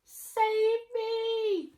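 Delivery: high, loud sing-song wail; high wailing plea; crying plea. Acted. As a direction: wail for help. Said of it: "cried Carrot".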